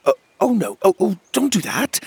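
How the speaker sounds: high, hurried flustered pleading. A narrator's reading. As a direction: panic gently as a baby starts crying. Fairyling starts to cry.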